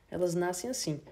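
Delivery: ordinary delivery, nothing marked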